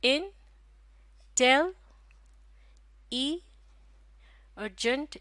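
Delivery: "इन, टेल, ई और जंट" with long pauses between beats; syllable by syllable. Shown as a demonstration. Teacher counting syllables for learners, syllable by syllable. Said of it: "तो ये कितने syllable हो गए?".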